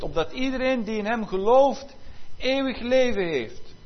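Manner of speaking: preacher lifting the verse up high; full-force preaching. Acted. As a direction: thunder the scripture to the congregation.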